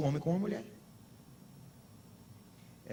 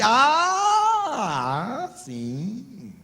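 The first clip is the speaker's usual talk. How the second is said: loud rising ah of getting it; the penny drops out loud; sudden realization; 8 semitones higher, 4 dB louder, much slower than usual. Retold or imitated. Friend finally understands the pastor's hint, exclaiming.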